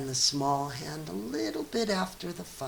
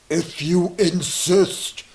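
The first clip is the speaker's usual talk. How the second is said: rhinoceros voice; 2 semitones higher, 8 dB louder, slower than usual. A narrator's reading. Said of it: "said the rhinoceros".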